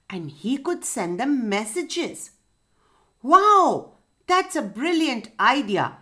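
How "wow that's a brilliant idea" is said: high, loud delighted wow; excited exclaim. Acted. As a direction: cheer at the brilliant idea. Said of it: "exclaimed the four friends".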